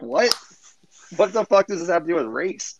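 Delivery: can't believe she said that; high, stunned outrage; incredulous disbelief. Retold or imitated. Stunned reply to racism accusation.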